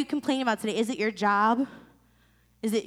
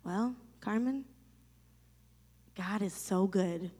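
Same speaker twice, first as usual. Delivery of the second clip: mom's calm reply; 4 semitones lower, 8 dB quieter, slower than usual. Retold or imitated. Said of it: "And my mom goes".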